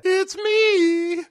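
very high squeaky cartoon head voice; a dead guard's head chirping hello; squeaky severed head.

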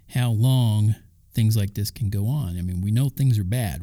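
usual speaking voice

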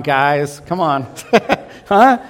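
ordinary delivery

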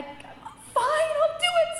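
overwhelmed surrender, pitched high; high giving in. Retold or imitated. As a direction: throw up your hands and give in, way up high. Giving in while sobbing.

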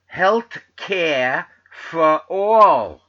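put-on booming, drawn-out New York accent; loud, very slow New York accent with dropped Rs; Bernie Sanders. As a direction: stretch it out like a New York stump speaker. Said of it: "Colonel, uh, Bernie Sanders says".

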